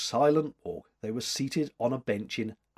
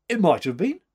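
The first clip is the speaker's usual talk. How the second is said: loud, higher reluctant admission; grudging; 6 semitones higher, 8 dB louder than usual. A narrator's reading. Man admitting he was there, grudging. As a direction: concede it reluctantly with a push. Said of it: "he grudged".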